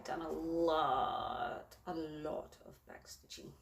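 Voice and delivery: slow, drawn-out emphasis. Real, not acted. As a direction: stretch it out to stress the effort. Stressing the huge amount of work.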